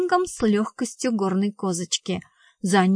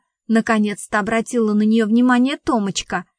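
usual speaking voice

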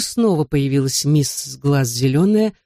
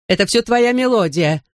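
higher angry shout; 6 semitones higher than usual. Acted. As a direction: burst in yelling. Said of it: "с криком ворвалась".

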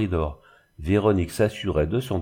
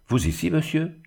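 higher-pitched, indignant question; mild anger; 8 semitones higher than usual. A narrator's reading. Young woman to an uninvited visitor, mildly angry and startled. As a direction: challenge him with understated storyteller's anger. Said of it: "dit-elle avec colère".

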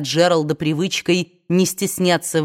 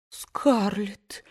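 whispered name in dismay; hushed; 2 semitones higher, 7 dB quieter than usual. A narrator's reading. Aunt gasps at Scarlett's words, whispered. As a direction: breathe the name in shock. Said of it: "всхлипнула тётушка Питтипэт".

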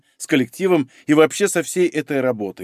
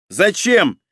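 higher, agitated comeback; nervy retort; 6 semitones higher than usual. Acted. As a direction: throw it back edgily, pitched up. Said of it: "в тон ему нервно ответил". (нервно, в тон собеседнику)

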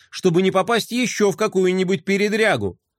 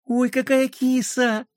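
умиление; 9 semitones higher, slower than usual. Acted. Восторг героини при виде кошки.

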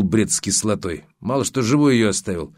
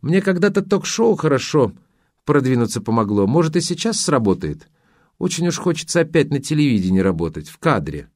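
higher, artless wishful chatter; pitched-up innocent ingenue, imitated; charming naivety; 5 semitones higher than usual. Woman wanting to be back on television, charmingly naive. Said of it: "с очаровательным простодушием ответила она".

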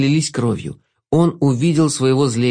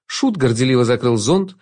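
usual speaking voice

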